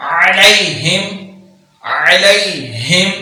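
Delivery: علیہم with the ع voiced clearly; clear ain. Shown as a demonstration. Teacher insists on pronouncing the ع. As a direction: sound the ع from the throat. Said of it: "عین کو پروناؤنس کریں گے".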